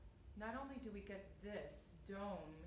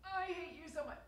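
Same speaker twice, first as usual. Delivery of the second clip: higher, louder line of hate; raised voice; 8 semitones higher, 7 dB louder than usual. Shown as a demonstration. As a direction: push it out in anger. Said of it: "you don't go".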